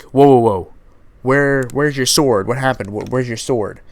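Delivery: a startled, loud "whoa" in a lightly done goofy villain voice; pink gorilla voice. Retold or imitated. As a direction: mimic the assassin like the pink gorilla. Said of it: "That's what his voice sounded like".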